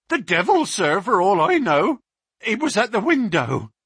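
the policeman still scared of what he saw; frightened constable. Acted. Constable Walters telling Baines of the devil, high and frightened.

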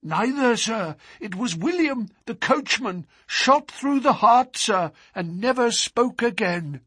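high-pitched, excited servant voice; agitated butler. A narrator's reading. Butler telling his master who was killed, agitated. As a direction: report the killing in a high fluster.

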